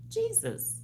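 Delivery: high exclamation. Acted.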